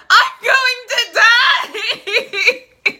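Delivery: high, drawn-out shriek breaking into laughter; mock screaming panic. Retelling the heroine's panic attack to viewers, theatrical scream. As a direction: scream it out, hammed up and very loud. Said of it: "She's basically screaming".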